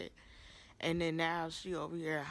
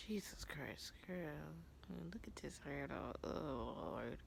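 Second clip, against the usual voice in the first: much softer, to herself; quiet muttering aside; 9 dB quieter than usual. Real, not acted. Vlogger to herself, softly dismayed at her hair. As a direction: drop to a soft aside.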